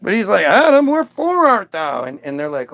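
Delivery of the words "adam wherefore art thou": booming God. Acted. God coming home calling for Adam, loud theatrical. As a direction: call out like a theatrical deity.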